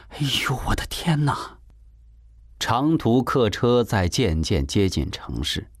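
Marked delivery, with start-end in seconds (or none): 0.1-1.6 higher, hushed gasp of wonder; tongue-clicking amazement. A narrator's reading. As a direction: marvel under your breath at something huge. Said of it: "舒慧直咂嘴啊". (咂着嘴惊叹)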